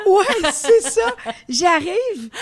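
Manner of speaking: oui c'est ça en riant aigu; rire. Real, not acted. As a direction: rire franc, la voix qui monte haut. Rire en se souvenant. (rit de bon cœur en se rappelant le festival)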